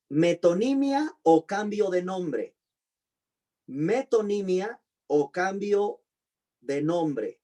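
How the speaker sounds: Metonimia o cambio said slowly and carefully; a teacher dictating metonimia to the class; slow dictation. Shown as a demonstration. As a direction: read it out slowly so students can copy it. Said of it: "teacher repeats term slowly".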